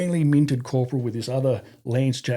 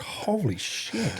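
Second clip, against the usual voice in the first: slow stunned holy shit; 10 semitones higher, 4 dB quieter, much slower than usual. Retold or imitated. Awed reaction to the briefing.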